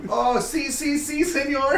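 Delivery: high-pitched cartoon Spanish "si, si, senor"; mock Spanish voice, high and playful; mock Spanish accent. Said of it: "making fun of him 'cause he's Spanish".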